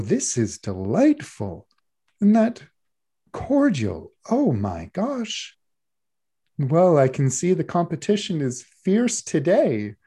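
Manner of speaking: slow, lightly voiced gracious judge; a hint of a doting baking judge; gushing judge. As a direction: savour each compliment like a kindly judge. Judge [name] tastes and praises.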